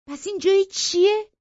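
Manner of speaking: loud, high-pitched challenge; indignant retort. Acted. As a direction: throw the proof back at her, outraged. Narrator angrily confronts her accuser.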